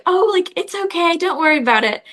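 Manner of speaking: put-on a light, polite British accent, brushing off the bump; Hugh Grant British accent. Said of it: "a very specific British accent that's like".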